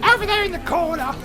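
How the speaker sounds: high put-on barmaid call; barmaid yelling. Barmaid yells order to the barman, high voice. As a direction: call the order across the bar, high-pitched. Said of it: "you hear her yelling to the barman".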